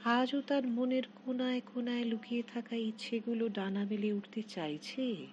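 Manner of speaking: ordinary delivery, nothing marked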